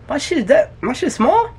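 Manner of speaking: normal talking voice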